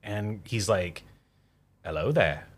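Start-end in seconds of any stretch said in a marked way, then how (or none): 1.8-2.5 a calm, dry Hello there; lightly voiced Obi-Wan; Obi-Wan impression. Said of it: "a really good impression".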